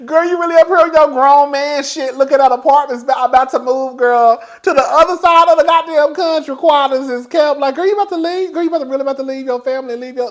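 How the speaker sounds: high, loud disbelieving scold; incredulous self-scolding. Retold or imitated. Scolding self, retold inner voice.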